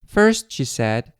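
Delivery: ordinary delivery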